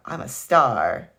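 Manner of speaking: slow, loud delighted claim; a loud, drawn-out boast; excited. A narrator's reading. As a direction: savor it with joy. Aunt Mira delighted at mirror.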